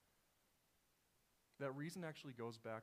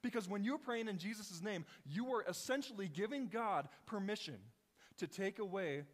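louder, higher, driving pulpit delivery; full-force preaching; 7 semitones higher, 6 dB louder than usual. Acted. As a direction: preach it hard and high.

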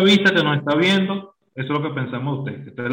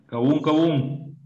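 mock foreign word; much slower than usual. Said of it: "mock name".

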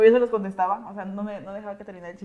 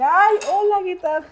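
fake cheerful greeting; 9 semitones higher, 9 dB louder, slower than usual. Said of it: "Y dije".

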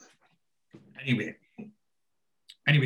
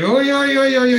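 high squealing yeah yeahs, much louder; excited squeal; 12 semitones higher, 8 dB louder than usual. Retold or imitated. Barry squealing loud and high over the girls staying. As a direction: squeal with delight.

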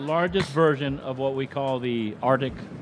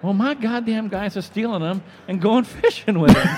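laughing while quoting; 7 semitones higher, 5 dB louder than usual. Retold or imitated. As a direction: quote broken by a laugh. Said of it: "He goes".

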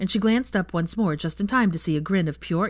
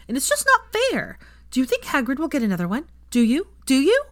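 put-on high, eager child's voice; excited little boy; 7 semitones higher, 3 dB louder than usual. A narrator's reading. Teddy begging Hermione about meeting a hippogriff, excited child. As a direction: plead like an overexcited six-year-old, pitch leaping up.

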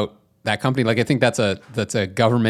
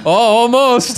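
higher, louder chipper "almost"; mock Canadian cheer; 11 semitones higher, 6 dB louder, slower than usual. Cheering on an injured man in mock Canadian accent. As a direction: brightly tease the injured guy, Canadian style. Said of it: "someone who's clearly in pain being like".

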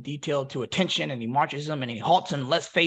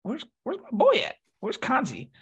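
calling for a friend; 9 semitones higher than usual. Retold or imitated. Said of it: "I look around like".

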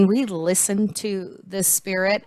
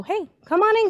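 excited welcome; 13 semitones higher, 4 dB louder, faster than usual. Pretend. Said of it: "just waiting for us to go".

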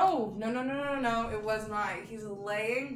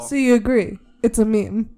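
much louder, pushing the point; louder insistence; 16 dB louder than usual. Shown as a demonstration. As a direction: press it home, loud and teasing. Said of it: "louder repeat".

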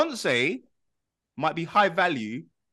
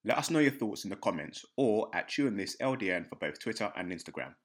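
low, soft host sign-off; podcast outro; 8 semitones lower, 10 dB quieter than usual. Acted. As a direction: wrap up the show calmly. Host signs off the episode.

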